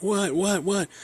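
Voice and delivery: put-on the boy yelping in fear at his dad; retold panic. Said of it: "I just start freaking out".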